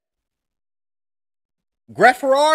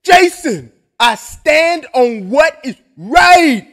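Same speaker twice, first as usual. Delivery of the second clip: loud, preachy impression of a fighter, building to a shout; hammed-up fighter preaching his principles, louder and louder; imitating Leon; 3 semitones higher, 6 dB louder, slower than usual. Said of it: "He'd be like, um".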